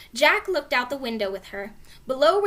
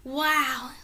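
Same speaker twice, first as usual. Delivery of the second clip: plain reading voice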